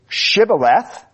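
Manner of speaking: "shibboleth" begun with a hushing SH sound. Shown as a demonstration. Preacher quoting the dialect test that saved lives, "shibboleth" with SH. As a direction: say "shibboleth" with SH at the start. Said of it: "could not pronounce it correctly".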